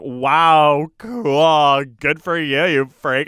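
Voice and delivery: loud mock-impressed sneer; retold sarcastic brush-off; mean put-down. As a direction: dismiss them with fake enthusiasm. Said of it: "a really mean way to behave".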